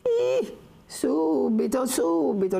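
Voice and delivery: very high, drawn-out eager answer; eager old woman. Acted. (la vecchia risponde subito, voce altissima)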